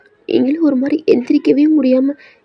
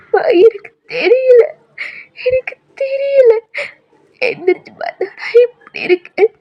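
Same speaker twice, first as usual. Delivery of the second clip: sobbing; 11 semitones higher, 3 dB louder than usual. Acted. Girl sobs to her friend who found her. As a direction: cry helplessly.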